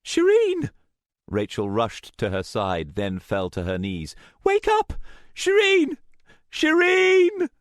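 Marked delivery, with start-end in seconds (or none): 0.0-0.7 loud, very high scream of a name; high-pitched alarmed shout; panicked cry. A narrator's reading. Cries to collapsed friend. 4.4-7.5 very high, loud cries; frantic high-pitched wail; desperate screaming. A narrator's reading. Begs collapsed friend to wake.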